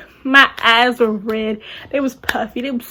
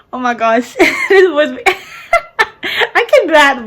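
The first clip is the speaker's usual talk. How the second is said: words broken by high laughter; real giggling, higher and louder; cracking up; 4 semitones higher, 5 dB louder than usual. Real, not acted. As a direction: try to talk through a laughing fit. Laughing fit interrupts the story.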